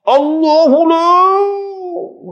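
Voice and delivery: very high, slow, stretched cry; over-the-top soap opera takbir; dramatic TV exorcist. Retold or imitated. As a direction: ham it up like a drama healer. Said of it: "Dia duduk tengok dalam drama".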